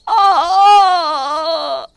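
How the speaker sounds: sharp loud cries of pain, high; scalding pain cry. Acted. Mother-in-law burned by tea, crying out loud. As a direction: yelp as hot tea burns her. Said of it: "Savita चिल्ला उठती है".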